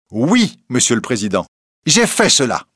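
proud, self-assured reply. A narrator's reading. Reporter replies to the president about what he did, proudly. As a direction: own up to it with pride. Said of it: "répliqua Rouletabille avec orgueil".

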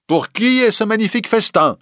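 voix d'évêque haute et hautaine; évêque hautain. A narrator's reading. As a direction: une voix plus haute pour l'évêque. L'évêque découvre le festin des bergers. (l'évêque interpelle les bergers d'un ton hautain)

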